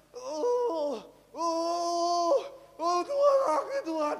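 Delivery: loud, high, drawn-out mock sobbing; imitated wailing. Preacher imitating a dying man crying to God, wailing. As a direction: wail out to God like a crying penitent. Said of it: "Uh, menangis-nangis".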